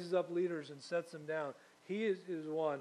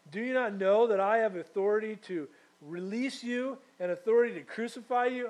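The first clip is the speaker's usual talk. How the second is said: higher and louder, an indignant ruler; angry Pilate; 5 semitones higher, 10 dB louder than usual. Acted. Pilate to Jesus, angry and loud. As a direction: bark it out like a proud governor.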